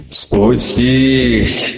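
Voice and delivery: insistent, lurking creature command; insistent monster. Acted. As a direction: press it like a patient monster. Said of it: "настаивал голос".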